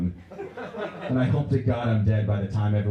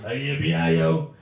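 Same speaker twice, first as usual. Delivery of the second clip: put-on drunk reply; 5 semitones higher, 4 dB louder, slower than usual. Drunk customer answers drive-thru worker.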